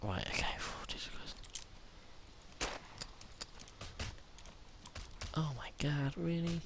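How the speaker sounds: hushed whisper while hiding; scared whispering while sneaking past zombies; tense whisper. Real, not acted. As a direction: whisper so the zombies won't hear.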